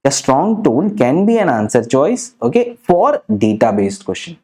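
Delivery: slow dictation. Shown as a demonstration. Rule repeated slowly for notes.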